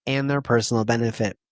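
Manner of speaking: ordinary talk, nothing marked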